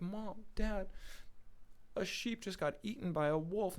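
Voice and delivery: put-on high, mildly upset child's voice; tearful. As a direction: act a distressed boy telling his parents. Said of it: "he's just crying".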